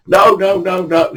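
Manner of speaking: high, hurried string of refusals; mock protest, pitched high; insistent refusal. Victor to fishing mate who wants to leave, insistent refusal.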